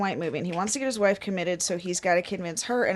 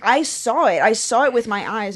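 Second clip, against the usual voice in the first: louder, insisting on what she saw; heated partner accusing, raised voice; insistent accusation; 2 semitones higher, 7 dB louder than usual. Retold or imitated. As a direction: hammer home that you saw it. Acted quarrel, insisting she saw.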